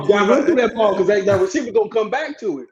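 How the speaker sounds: loud, high coaching call. Retold or imitated. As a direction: bark it like a coach on the field. Coaching a drill loudly.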